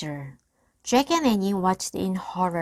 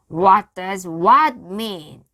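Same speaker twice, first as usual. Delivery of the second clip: slow blustering question; 9 dB louder, slower than usual. A narrator's reading. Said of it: "pirate stalling, confused".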